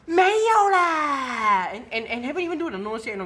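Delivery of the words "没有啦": high, very drawn-out Singaporean Chinese whine; loud, hammed-up Singaporean Chinese accent, stretching each ending. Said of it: "this is with the eyes".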